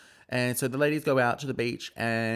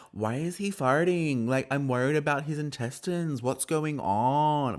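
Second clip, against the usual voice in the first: Mary; 6 semitones higher than usual. Retold or imitated. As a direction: stretch it out high, slightly Canadian. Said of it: "That's more Canadian".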